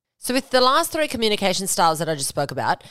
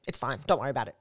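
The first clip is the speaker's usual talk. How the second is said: low, very soft dismissal; sulky brush-off; 6 semitones lower, 8 dB quieter, much faster than usual. Acted. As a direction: act out withdrawing in a quiet sulk. Acted sulky partner brushing off help.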